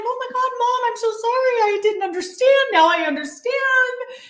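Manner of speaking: very high, overwrought retold apology; frantic apology. Shaken daughter telling mom she now understands, frantic apology. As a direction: plead with mom, voice pitched right up.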